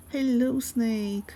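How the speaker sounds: high, loud hello; real delighted hello; excited greeting. Real, not acted. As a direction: call out a happy hello. Greeting a chatter.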